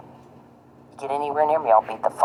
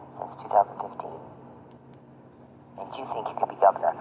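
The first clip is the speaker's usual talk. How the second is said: whisper; 3 semitones lower, 8 dB quieter than usual. Real, not acted. Taunting Charles.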